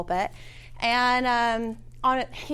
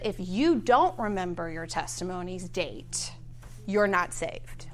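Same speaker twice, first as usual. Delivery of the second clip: serious warning; 5 semitones lower, 4 dB quieter than usual. Retold or imitated. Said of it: "very seriously".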